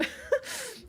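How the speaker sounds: a quick high amused laugh; short high-pitched chuckle. Real, not acted. Laughs at the nurses' line.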